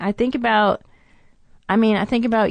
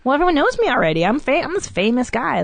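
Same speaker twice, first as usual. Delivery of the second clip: higher-pitched, self-satisfied imitation; mock cocky; 6 semitones higher than usual. Comic resting on his laurels, mock cocky. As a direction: brag as a comic who thinks he's made it.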